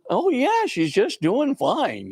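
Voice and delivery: put-on high-pitched, breezy little boy; reassuring child. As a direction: imitate a boy casually reassuring his mom. Boy reassures his crying mother.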